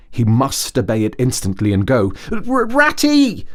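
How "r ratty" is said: high, excited little Mole call; high and eager, drawn-out Mole voice; Mole calling joyfully. A narrator's reading. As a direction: call out to Ratty, thrilled. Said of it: "he called, full of joyful excitement".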